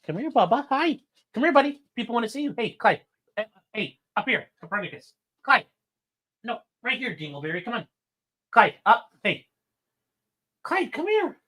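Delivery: high, sing-song pet talk; coaxing the dog. Real, not acted. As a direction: coax the dog up, sweet and high.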